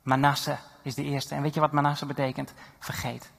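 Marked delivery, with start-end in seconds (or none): 2.8-3.3 soft whispered single word; hushed whisper. Acted. Preacher telling the congregation what Manasse means, whispered. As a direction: let the word fall as a secret. Preacher reveals the name's meaning.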